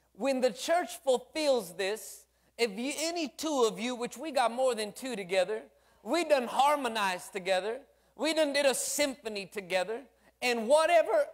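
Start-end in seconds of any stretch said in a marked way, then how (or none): none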